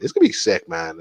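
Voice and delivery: sick jumps way up in pitch; excited. Real, not acted. Host hyping his new show, excited high burst. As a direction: hype it up, voice leaping high.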